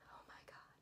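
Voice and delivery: whispered, breath-only "oh my God"; awed whisper. Real, not acted. Fan remembering the set, awed whisper. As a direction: drop to a whisper, overwhelmed. Overwhelmed aside while retelling.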